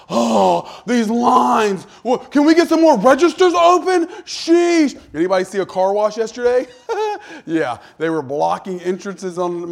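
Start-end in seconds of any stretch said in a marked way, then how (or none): 0.1-5.0 loud, much higher exasperated whine; annoyed shopper loudly griping; perturbed complaining. Retold or imitated. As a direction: huff about the long lines, loud and high. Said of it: "you ever been perturbed at the grocery store".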